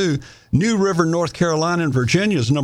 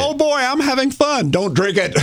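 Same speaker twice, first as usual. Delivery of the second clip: mock happy swimmer; 11 semitones higher than usual. Mocking swimmers in dirty river.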